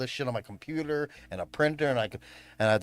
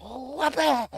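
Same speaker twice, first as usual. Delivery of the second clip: high, punchy "Wha-bam!" like a cartoon hit; mock punch sound; 10 semitones higher than usual. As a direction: smack the sound out with a sharp top. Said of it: "fucking went".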